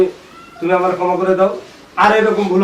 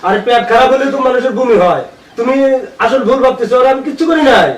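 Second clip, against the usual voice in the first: loud, higher-pitched protesting; heated denial; 4 semitones higher, 5 dB louder than usual. Acted. Husband explaining away maid's vomiting, heated denial. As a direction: insist on your innocence forcefully.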